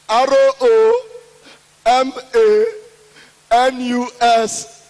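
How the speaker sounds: slow, loud letter-by-letter spelling; spelled out. Acted. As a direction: spell it out slowly, stressing each letter. Spelling name out slowly, emphatically.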